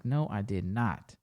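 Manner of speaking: low, soft, slow denial; firm denial. Retold or imitated. Man contradicting her reading of his life, firm. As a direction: spell it out slowly, dropped in pitch.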